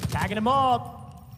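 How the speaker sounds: rising hype play-by-play call; esports caster. Real, not acted. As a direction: call the action live, building up. Live play-by-play.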